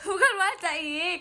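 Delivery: high squeal through laughter; laughing denial. Real, not acted. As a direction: laugh it out, denying. (menyangkal sambil tertawa)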